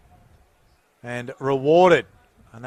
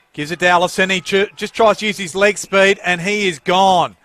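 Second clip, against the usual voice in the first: loud commentator's call of a chase; excited play-by-play; 4 semitones higher, 7 dB louder than usual. Real, not acted. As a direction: punch it louder, stretch the final phrase. Live play-by-play.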